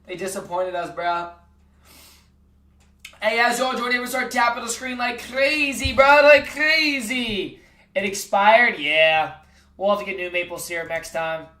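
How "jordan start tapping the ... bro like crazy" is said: Like crazy stretched out slowly, Like stressed; slow emphatic urging. Real, not acted. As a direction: drag out Like crazy to hype chat. Urging chat to tap screen.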